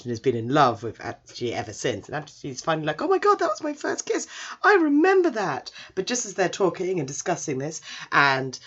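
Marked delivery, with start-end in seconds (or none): 3.0-5.7 very high, mock-amazed gasping tone; hammed-up squeaky astonishment; shocked realisation. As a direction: act out a sudden startled memory. Boy suddenly recalls first kiss.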